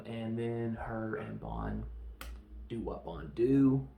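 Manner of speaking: ordinary talk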